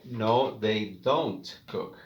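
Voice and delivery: slow, careful "they don't cook"; slow model answer. Shown as a demonstration. Teacher to class, slowly modelling the negative.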